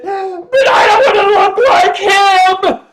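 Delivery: put-on high, loud sobbing cry of a child; sobbing shout. Crying boy shouting at his dad at dinner. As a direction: wail it like a crying five-year-old. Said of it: "burst into tears and started sobbing hysterically".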